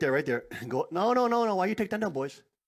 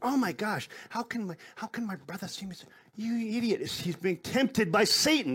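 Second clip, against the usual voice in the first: higher, mock-exasperated kid scolding a brother; exasperated sibling; 9 semitones higher than usual. Preacher voices a child upset at a tempted brother, mildly exasperated. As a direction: play a fed-up child calling a brother an idiot.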